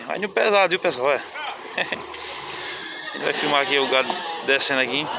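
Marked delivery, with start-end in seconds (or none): 1.2-3.1 soft breathy laugh. Real, not acted. Vlogger laughing softly to himself at the fair. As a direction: let out a quiet airy laugh.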